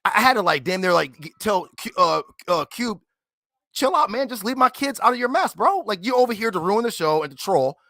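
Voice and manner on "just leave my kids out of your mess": ordinary delivery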